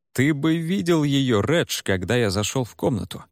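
ordinary delivery, nothing marked

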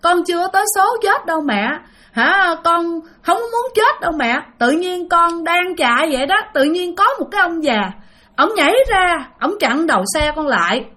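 a retold ghost son begging his mother, half-tearful; tearful pleading. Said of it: "nó khóc với bác quá trời luôn".

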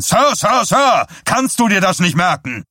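put-on mayor screaming at the boy to say sir; yelling. A narrator's reading. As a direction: scream it at him in rage. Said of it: "schrie Stilson ihn an".

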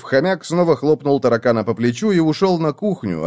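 usual speaking voice

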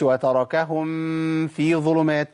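في stressed, mim of هم cut off with no hum; slow wrong tajweed demo; wrong recitation. Shown as a demonstration. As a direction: recite وتركهم في ظلمات badly, no ghunnah on هم. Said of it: "ما اقولش".

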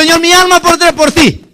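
high, loud pledge in Peter's mouth; impassioned vow. Acted. Peter pledges his soul to the Lord, high and impassioned. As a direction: declare it at full fervor.

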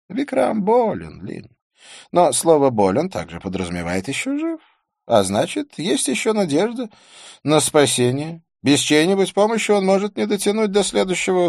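high-pitched character voice, earnest and reassuring; Didier. Acted.

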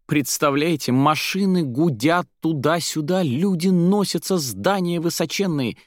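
high-pitched, breathless-with-wonder gushing; wide-eyed excited description. A narrator's reading. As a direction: pour out the marvels, swooping high. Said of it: "взахлёб описывала Заира".

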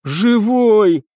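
high-pitched cry of relief; relieved exclamation. A narrator's reading. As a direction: cry out, overjoyed he made it. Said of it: "облегчённо воскликнул ещё недавно заносчивый курсант".

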